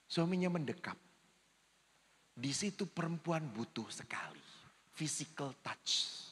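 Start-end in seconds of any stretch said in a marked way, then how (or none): 2.3-6.2 hushed, unvoiced confiding whisper; a priest whispering the point to the congregation. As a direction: lean in and whisper it to them.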